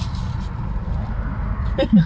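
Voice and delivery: breathy laugh. Real, not acted. Laughing at the joke.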